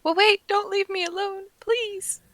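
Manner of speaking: high-pitched acted plea; drawn-out, forlorn "please"; pleading Edda. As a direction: beg in a small high voice not to be abandoned. Voices Edda begging not to be left.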